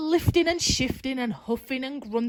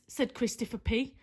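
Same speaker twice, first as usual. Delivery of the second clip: ordinary delivery, nothing marked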